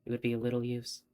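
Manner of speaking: reading the game text aloud as a story; story narration. Acted. Streamer narrating the novel for viewers, storybook tone.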